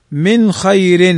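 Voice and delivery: من خير slowly, nun clearly sounded before the kha; slow clear reading. Shown as a demonstration. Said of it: "نون ساكنة بعدها الخاء". (قراءة بطيئة لـ من خير مع إظهار النون)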